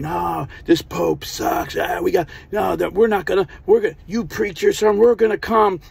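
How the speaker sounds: high-pitched mock angry crowd; rebellious townspeople. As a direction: rant fast and high like protesters stirring up revolt.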